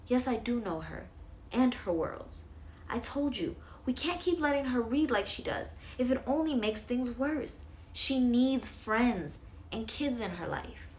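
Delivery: mom arguing. A narrator's reading. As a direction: press every point as a worried mother.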